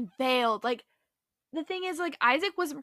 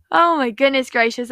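hyped up; 10 dB louder than usual. Real, not acted. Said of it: "It just gets me literally hyped up".

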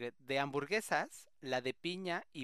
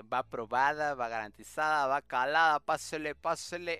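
loud market vendor calling out; hyped fairground barker; 8 dB louder than usual. Pretend. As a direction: hawk it like a street seller. Selling burger like market vendor.

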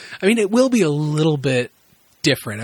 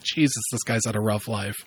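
quieter, lower dry aside; muttered aside; 5 semitones lower, 5 dB quieter than usual. Retold or imitated. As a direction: mutter it to yourself in disbelief. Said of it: "I'm like".

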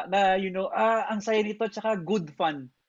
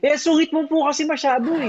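teasing; 10 semitones higher, 6 dB louder than usual. Real, not acted. Teasing remark between friends.

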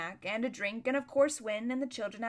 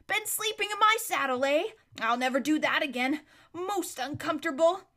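character voice; 6 semitones higher, 4 dB louder than usual. A narrator's reading. Bree voiced as pompous horse.

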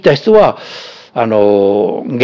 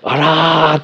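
dismayed exclamation; 7 semitones higher, much slower than usual. Retold or imitated. 呆れた心の声の再現.

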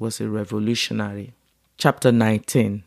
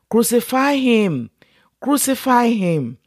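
much higher, urgent shouted demand; shouting; 11 semitones higher than usual. A narrator's reading. Priests and guards shouting at Pilate, high urgent shout. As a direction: cry out for his death. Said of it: "began shouting".

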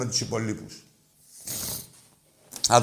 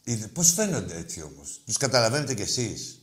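usual speaking voice

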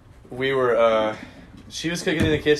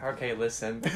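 soft, lower, lightly acted preamble; patient explaining; 5 semitones lower, 11 dB quieter than usual. Girlfriend about to explain to boyfriend, soft and patient. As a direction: gently begin an awkward explanation.

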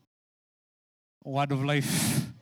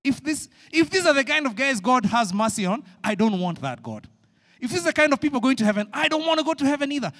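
louder, higher indignant rant; indignant Jonah; 6 semitones higher, 5 dB louder than usual. Acted. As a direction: Jonah refusing such a God. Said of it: "Jonah looking at these guys and saying".

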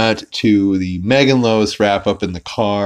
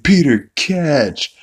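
high, drawn-out call of a name; mock call to a friend to catch; 7 semitones higher, 3 dB louder, slower than usual. Joe tosses Peter the spear gun, calling out. Retold character calls out a throw.

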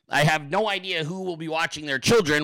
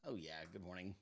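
ordinary talk, nothing marked

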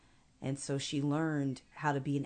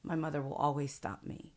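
usual speaking voice

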